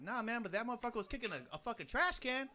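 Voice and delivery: retold frantic excuse; pleading explanation. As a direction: rush to explain to the cops. Explaining to cops.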